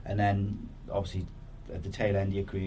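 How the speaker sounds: ordinary delivery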